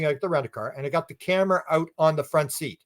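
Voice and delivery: ordinary delivery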